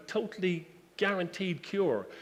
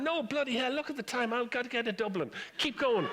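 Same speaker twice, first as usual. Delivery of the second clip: higher, mock exasperated insistence; impatient passenger; 9 semitones higher than usual. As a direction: passenger tells crew not to stop at the Isle of Man, impatient. Irish passenger refusing stop.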